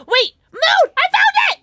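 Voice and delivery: high, loud squeals of discovery; excited shrieking. Real, not acted. Player spotting the moon key, excited high shrieks. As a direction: shriek it out as if you just struck gold. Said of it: "I got excited".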